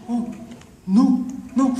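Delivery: high little imitated denial; evasive beggar. Beggar not even checking his pockets, feigned innocence. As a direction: deny it in a high, innocent put-on voice.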